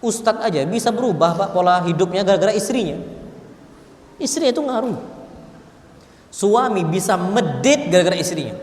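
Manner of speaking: usual speaking voice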